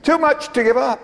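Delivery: high, ringing "Too much to give up"; incredulous echo. Acted. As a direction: echo it back as if it's absurd. Preacher echoes in disbelief.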